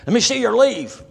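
high, loud military demand; demanding sergeant. Retold or imitated. Sergeant checks AWOL airman's leave, barking sharply. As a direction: bark the order at a subordinate.

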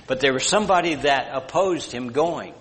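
ordinary delivery

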